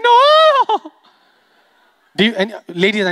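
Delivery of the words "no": high, loud mock fan squeal; imitated squeal. Comic teasing a man in the crowd, imitated high squeal. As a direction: let out one long shrill-pitched no. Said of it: "You just went".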